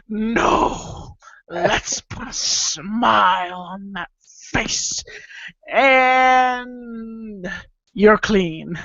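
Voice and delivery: slow, stretched villain voice; Joker parody. Acted. Mad father to his child, drawn-out Joker menace. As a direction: drag the words out with theatrical menace. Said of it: "Joker colonoscopy".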